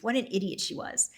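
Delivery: ordinary delivery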